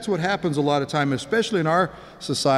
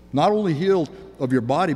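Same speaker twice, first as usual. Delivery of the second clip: ordinary delivery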